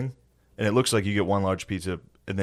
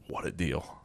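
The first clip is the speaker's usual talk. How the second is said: whisper; 4 semitones lower, 12 dB quieter, slower than usual. Retold or imitated. Whispered aside in retold thought.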